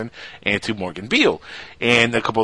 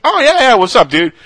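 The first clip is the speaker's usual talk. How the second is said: imitating a friendly guy; 12 semitones higher, 8 dB louder than usual. Voicing Morgan on the phone.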